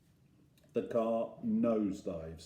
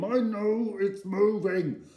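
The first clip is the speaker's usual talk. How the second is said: strained, drawn-out grunt, pitched up; grunting back; 9 semitones higher, 5 dB louder, slower than usual. A narrator's reading. Dad hanging on to the car, strained grunting. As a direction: grunt it loudly and slowly with effort. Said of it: "I'm grunting back at him".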